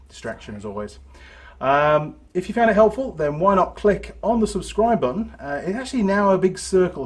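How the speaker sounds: loud, upbeat sign-off to viewers; YouTuber outro. Acted. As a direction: wrap it up for the channel.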